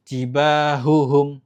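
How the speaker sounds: jibahuhum slow, both h sounds distinct; clear double h. Shown as a demonstration. Teacher demonstrating the correct jibahuhum. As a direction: separate hu and hum in jibahuhum. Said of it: "Yang benar".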